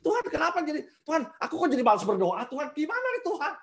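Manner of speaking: high, plaintive complaint to God; pleading like a lazy believer; mock whiny prayer. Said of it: "Ngomongnya ke Tuhan". (mengadu kepada Tuhan dengan suara tinggi merengek)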